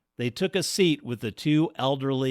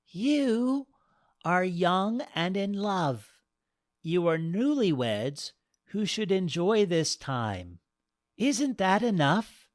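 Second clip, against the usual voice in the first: put-on higher, tender old woman; kind goddess voice; 9 semitones higher than usual. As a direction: reassure them kindly.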